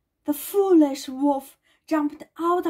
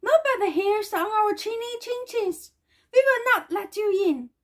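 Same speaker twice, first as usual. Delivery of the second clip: high squeaked refusal; high-pitched piggy voice; squeaky little pigs; 6 semitones higher than usual. A narrator's reading. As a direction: squeak it like storybook piglets. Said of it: "squeaked the little pigs".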